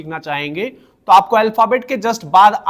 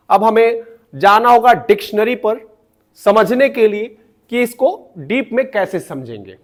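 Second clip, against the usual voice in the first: ordinary talk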